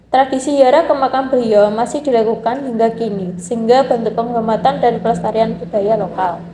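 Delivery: usual speaking voice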